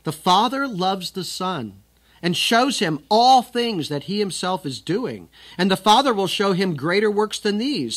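declaiming scripture to the congregation; forceful scripture reading. Acted. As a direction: preach the text out with weight.

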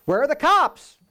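high, loud mock call for the police; mock crowd outcry. Preacher voicing the puzzled crowd, high loud mock outcry. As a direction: play the puzzled crowd demanding an arrest.

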